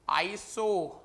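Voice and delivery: ordinary delivery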